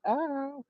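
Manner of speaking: soft high yelp of ow; a short high club ow, kept soft. Retold or imitated. Artist in the booth ad-libbing, a soft high yelp. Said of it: "Legends in the booth like".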